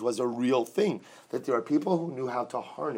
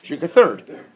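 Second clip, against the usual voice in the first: louder, punched-out answer; emphatic answer; 4 semitones higher, 13 dB louder, slower than usual. Shown as a demonstration. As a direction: call out the remedy loudly. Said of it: "Which is what?".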